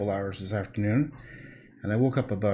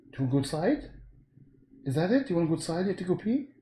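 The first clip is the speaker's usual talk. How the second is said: higher, coaxing questions to the dog; pet talk; 6 semitones higher, faster than usual. Real, not acted. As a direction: ask the dog sweetly, higher. Asking dog if it needs out.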